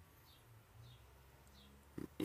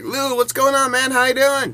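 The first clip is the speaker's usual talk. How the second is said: loud, high, eager hail; calling out; 12 dB louder than usual. Retold or imitated. Fan trying to get Lou to look up, loud eager call. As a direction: call out brightly to get his attention.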